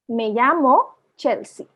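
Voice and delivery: very slow "Me llamo Chelsea", word by word; careful teacher's model, words spaced apart; slow pronunciation demo. Shown as a demonstration. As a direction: say "Me llamo Chelsea" slowly, "llamo" with a y sound. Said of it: "या करके pronounce करता है".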